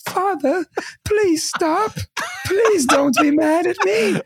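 high, whimpering mock plea; teasing, hammed-up falsetto begging; mocking brother. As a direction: plead slowly like a pitiful storybook orphan. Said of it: "my brother's like".

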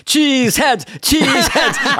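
high, drawn-out stage catchphrase; hammed-up crowd-pleasing chant; Wayne and Garth. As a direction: call it out to a crowd in a goofy high voice. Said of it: "I don't know if we're doing Wayne and Garth".

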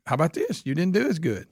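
ordinary delivery

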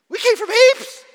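high, loud, silly dim student; mocking student voice. Pastor mocking a student who accepts anything, in a high silly voice. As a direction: sing it out like a clueless pupil.

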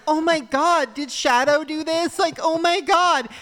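high, loud, mock-shocked squeal; the mom blames the dog, shrieking in alarm. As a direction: gasp it out like a scandalized mom. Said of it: "And it's like".

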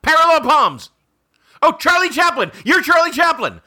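high, loud eureka guess; gleeful shout of a charades answer; excited guessing. Real, not acted. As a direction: blurt it out like you cracked it.

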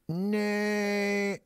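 high, long-held whinnying nay; horse character. Acted. Giving a riddle as a character, high drawn-out horse nay. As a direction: neigh the word out like a horse. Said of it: "I like to do my characters".

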